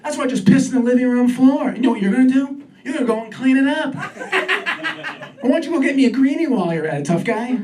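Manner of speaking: defiant Chihuahua voiced by its owner, mocking; cocky dog voice. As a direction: sneer at the owner as a little dog. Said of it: "Glaring at me like".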